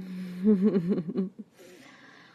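loud rippling chuckle; throaty burst of laughter. Acted. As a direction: let out a rolling laugh. Amused reaction between whispered lines.

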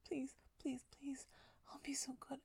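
normal talking voice

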